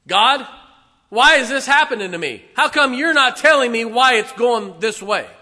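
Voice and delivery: loud, high demand for answers; mock-aggrieved questioning of God; demanding believer. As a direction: plead and insist at God. Preacher voices a complaining believer.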